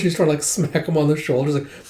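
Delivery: usual speaking voice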